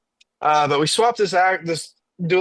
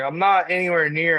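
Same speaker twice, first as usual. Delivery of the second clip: normal talking voice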